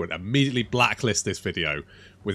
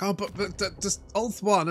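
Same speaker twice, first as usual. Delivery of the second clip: higher, stammering mock objection; mock-sputtering protest in a higher pitch; imagined objecting viewer; 9 semitones higher than usual. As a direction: imitate a flustered objector, high and halting. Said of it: "a few people will be like".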